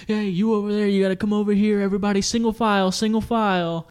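loud, high camp counselor shout; rally the group like a camp counselor; camp counselor call. Pretend. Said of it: "you gotta be like".

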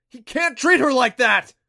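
loud, high outraged shout; bellowed protest. A narrator's reading. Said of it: "he bellowed".

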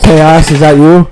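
voice filter. Pretend. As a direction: call out through a distorting filter. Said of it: "With the fucking filter".

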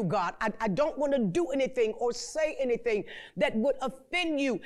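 normal talking voice

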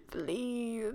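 laughing through a begging please; giggly mock pleading. Real, not acted. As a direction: beg while cracking up. Laughing while begging Eddie.

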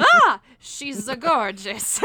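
slow, loud, laughing mock gasp of awe; mock smitten scream. Friend quoting Paolo seeing Mia, hammed-up loud awe. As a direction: gush it out like a dazzled suitor. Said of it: "it's like the scream of".

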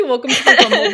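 imitating a sitcom announcer; chirpy Disney Channel intro; TV show intro voice. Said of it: "You started to say".

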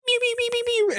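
high, soft cat meows; imitated meowing. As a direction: mew like a tiny kitten, very high. Imitating a movie sound gag.

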